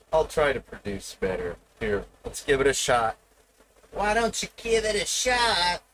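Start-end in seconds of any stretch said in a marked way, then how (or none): 3.9-5.8 high, put-on voice of the hive-mind chat; hives impression. Streamer imitating his chat egging him on, high put-on voice. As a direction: mimic the chat's teasing challenge, pitched up. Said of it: "as the hives might say".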